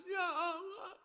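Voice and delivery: high-pitched wailed plea; put-on tearful wail; mock crying man. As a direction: imitate a man weeping in prayer. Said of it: "Yang laki-laki menangis".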